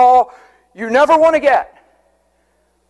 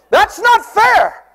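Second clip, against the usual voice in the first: mock protest; 10 semitones higher, much slower than usual. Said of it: "slow mocking repeat".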